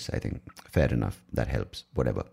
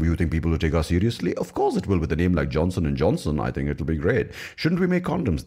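swooping, silly mock pitch meeting; mock company founders; 3 semitones higher than usual. Acted dialogue of two company founders.

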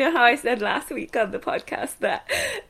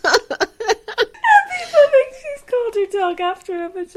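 laughing; 12 semitones higher, 3 dB louder than usual. Real, not acted. Punchline of the dog story.

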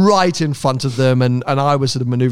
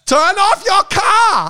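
high, drawn-out scolding shriek; slow, very high-pitched mock yell; mock angry yelling; much slower than usual. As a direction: imitate an irate Dutch woman ordering you. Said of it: "this woman starts coming up to my car and yelling".

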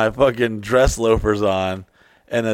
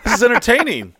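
high, loud and eager, pushing back; excited protest; 11 semitones higher, 6 dB louder than usual. Real, not acted. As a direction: jump in with bright insistence. Insists the story is entertaining.

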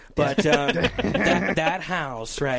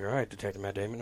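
quiet compliance; 7 semitones lower, 8 dB quieter, faster than usual. Retold or imitated. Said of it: "I'm like".

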